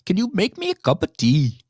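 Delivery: a rough attempt at a Liverpool accent. Shown as a demonstration. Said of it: "this is my Liverpool accent".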